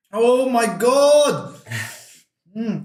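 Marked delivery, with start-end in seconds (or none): 0.1-1.5 loud, high, drawn-out oh my God; surprised exclamation. Real, not acted. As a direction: stretch it in shock. Reacting to hot sauce pain.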